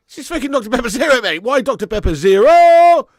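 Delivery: high, very loud ranting; exasperated shouting. Real, not acted. Exasperated rant at the product name.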